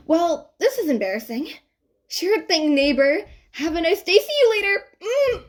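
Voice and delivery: covering embarrassment and fleeing; bright panicky farewell; flustered goodbye. A narrator's reading.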